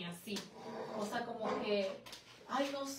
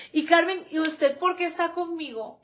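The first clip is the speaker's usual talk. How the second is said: loud, raised-pitch questioning; direct question; 4 semitones higher, 9 dB louder than usual. Real, not acted. Turns from the comments to question Carmen, loud direct question. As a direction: put the question loudly to her.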